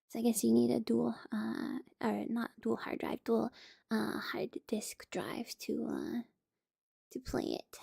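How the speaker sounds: soft ASMR whisper; slow hushed reading. Real, not acted.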